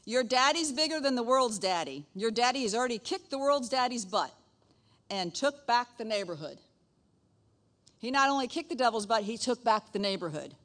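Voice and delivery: loud, high preaching push; big rousing pulpit voice. Real, not acted. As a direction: preach it out with full force. Preaching to congregation.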